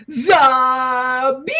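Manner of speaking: high, drawn-out mock battle cry; quoted shout. Host quoting the dancers' cry to co-hosts, mock shouting. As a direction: stretch it out as an imitation shout. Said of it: "they just shout, and I quote".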